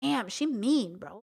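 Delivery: ordinary delivery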